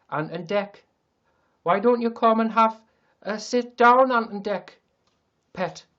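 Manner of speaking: loud, high-pitched mock Geordie; Newcastle accent. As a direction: belt it out in a hammed-up Newcastle accent. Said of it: "I can do, you know, the Newcastle".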